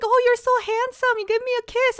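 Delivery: high, loud mock gushing over a dog; doting dad. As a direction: imitate a dad fawning over his puppy. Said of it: "my dad doesn't talk like that".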